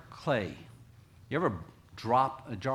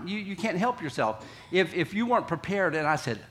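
ordinary talk, nothing marked

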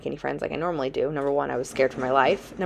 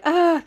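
imitated scream; 13 semitones higher, 5 dB louder than usual. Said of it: "this guy's screaming".

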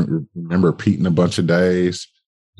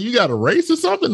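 much higher, put-on clerk voice; store clerk voice; faster than usual. Shoe store clerk asking the customer, high put-on voice. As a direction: play the puzzled shop clerk way up high.

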